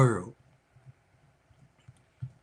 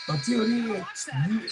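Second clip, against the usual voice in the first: ordinary talk, nothing marked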